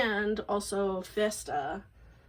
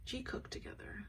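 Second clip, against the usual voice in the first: whispered; 4 semitones higher, 14 dB quieter than usual. Real, not acted. Hushed wish.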